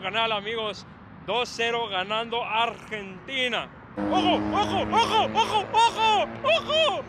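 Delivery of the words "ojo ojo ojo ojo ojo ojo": rapid high, loud alarm calls; urgent excited shouting. Real, not acted. Fan warning as México nears a goal, urgent shouting. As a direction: cry out, climbing higher with each warning.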